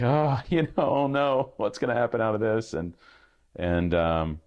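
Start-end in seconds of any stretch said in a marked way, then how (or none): 0.0-2.7 high, loud nervous giggle of dread; nervous laughing oh no. Retold or imitated. After hitting send to dad, high panicked laughing. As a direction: laugh out the panic as the email goes. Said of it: "I was like".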